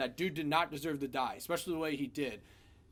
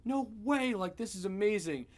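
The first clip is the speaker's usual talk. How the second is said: thrilled quote; 7 semitones higher, 4 dB louder than usual. Retold or imitated. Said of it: "He's like".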